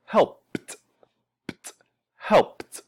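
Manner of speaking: "helped" as "helpt", final "pt" split off; one-syllable "helped" with released "t"; T ending demonstrated. Shown as a demonstration. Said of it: "it's not voiced".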